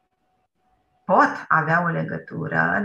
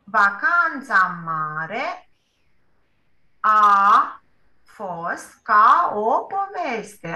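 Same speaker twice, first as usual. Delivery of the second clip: slow, drawn-out model sentence with a louder pause on a; teacher spelling out the sentence word by word; slow modelling; 2 semitones higher, 4 dB louder, much slower than usual. Shown as a demonstration. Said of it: "Ia să vedem dacă așa și este".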